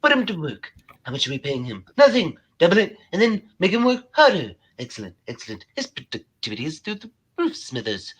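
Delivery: plotting tycoon commanding an underling; scheming boss. Acted. Cartoon boss ordering Smithers about.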